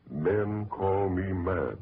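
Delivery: ordinary talk, nothing marked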